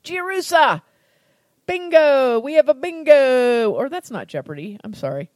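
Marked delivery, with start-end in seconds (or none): 0.0-3.7 loud and high, a winner's shout; excited. Real, not acted. Host cheers when cohost finally names the brand. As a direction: call it out like a game show host.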